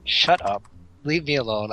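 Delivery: normal talking voice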